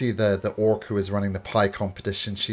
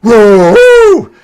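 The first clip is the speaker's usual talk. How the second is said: loud excited whoop; crowd cheering; 12 semitones higher, 19 dB louder than usual. Acted. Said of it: "The crowd goes".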